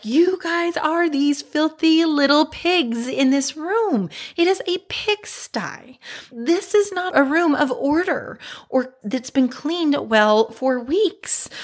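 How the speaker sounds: retold scolding of messy kids; imitating angry yelling. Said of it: "yelling, judging them".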